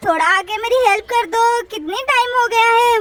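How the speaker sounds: ordinary delivery, nothing marked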